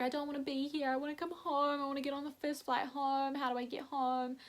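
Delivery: high, strained plea to go home; teary pleading. Retold or imitated. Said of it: "I was just crying".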